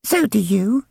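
put-on high, plain-spoken child; honest little girl. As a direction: say it matter-of-factly.

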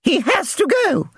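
very high shriek; shrieking chairwoman. Acted. Chairwoman mother addressing the residents' committee, shrieking. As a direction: shriek it from the stage. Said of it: "she shrieked".

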